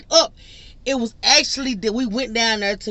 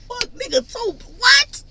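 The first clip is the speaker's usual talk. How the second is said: high-pitched what the; shocked disbelief; 14 semitones higher than usual. Real, not acted. Hearing he'll smoke two blunts, shrieks in shocked disbelief.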